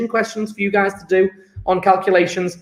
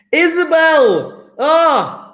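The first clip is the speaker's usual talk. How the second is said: high, loud, drawn-out name call; delighted call; 8 semitones higher, 5 dB louder, much slower than usual. Real, not acted. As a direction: cry out as the latecomer appears. Awaited pupil finally arrives.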